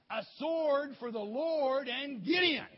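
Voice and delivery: loud, drawn-out chant for others to repeat; rallying cry. Shown as a demonstration. Preacher gets listeners to say it, shouted war cry. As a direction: lead the crowd in a high shout. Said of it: "Can you say that?".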